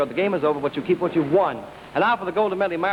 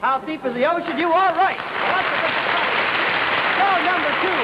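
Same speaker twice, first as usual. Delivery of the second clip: excited; 12 semitones higher than usual. Real, not acted. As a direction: cheer the right answer. Host excited at a correct answer.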